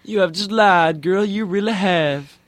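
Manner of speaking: high, loud sassy rebuke; sassy scolding Jesus. Retold or imitated. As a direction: tease her for lying. Other speaker echoes Jesus.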